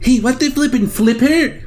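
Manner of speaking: high, loud playful exclamation; mock outrage. Host reacting to a censored cuss word, high mock outrage. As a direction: feign shock at the censor.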